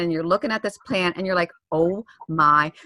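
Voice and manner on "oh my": fearful. Retold or imitated. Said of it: "I'm at that fearful point".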